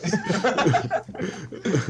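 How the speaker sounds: talking through laughter; giggling while explaining; laughing. Real, not acted.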